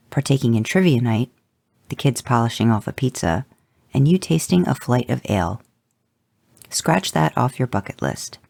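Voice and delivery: usual speaking voice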